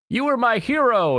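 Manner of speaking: high-pitched gushing fan quote; gushing praise. Retold or imitated. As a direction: imitate an adoring admirer, voice soaring. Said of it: "all, like, praising of him".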